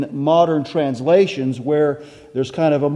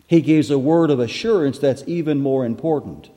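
ordinary delivery

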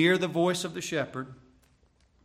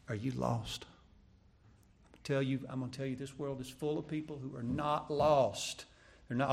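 usual speaking voice

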